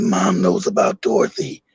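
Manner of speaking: hushed, low secretive murmur; whispering. Retold or imitated. Brother warns brother that Mom knows, whispering. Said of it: "all that whispering".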